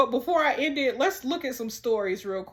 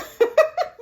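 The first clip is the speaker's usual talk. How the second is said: loud, high cackle; burst of laughter; 7 dB louder than usual. Real, not acted. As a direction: crack up out loud at yourself. Laughs at her own mistake.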